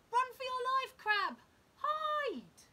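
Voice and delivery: loud, high urgent cry; urgent warning. A narrator's reading. Narrator warning the crab of the gull, loud and urgent. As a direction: shout the warning to save him. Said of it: "narrator warns of gull".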